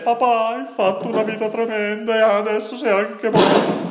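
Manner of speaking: high, loud mock wailing lament, hammed up. Preacher mocks a mourner's lament to his father, high hammed-up wail. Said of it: "uno dice".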